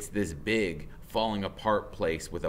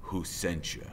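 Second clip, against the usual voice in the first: low menace; 4 semitones lower, 6 dB quieter than usual. Acted. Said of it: "he says".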